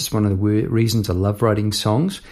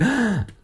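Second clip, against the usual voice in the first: gasp; 11 semitones higher, 4 dB quieter than usual. Pretend. Mock surprise at own song.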